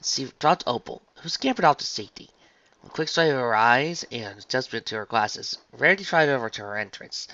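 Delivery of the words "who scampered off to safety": usual speaking voice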